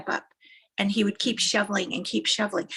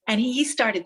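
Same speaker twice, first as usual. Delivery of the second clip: ordinary talk, nothing marked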